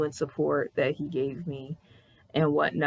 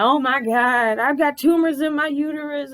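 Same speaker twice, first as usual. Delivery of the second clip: loud, high mock wail; hammed-up, high-pitched sobbing lament; imitated bawling; 9 semitones higher, 5 dB louder than usual. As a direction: act out crying your heart out. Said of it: "crying my heart out".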